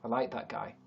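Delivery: ordinary delivery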